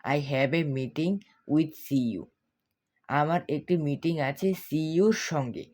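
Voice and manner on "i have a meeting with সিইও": slow, careful English model sentence; teacher reading the example slowly. Shown as a demonstration. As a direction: say each word slowly and clearly for learners. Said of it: "হবে এভাবে".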